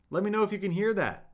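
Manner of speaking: ordinary talk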